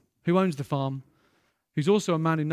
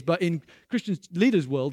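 usual speaking voice

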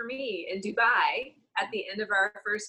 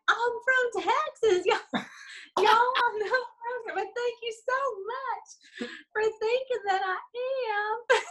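a higher, slow hammed-up Texas drawl; Texas accent; 11 semitones higher than usual. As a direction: play up the Texas accent, slowly. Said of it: "turned up the Texas accent".

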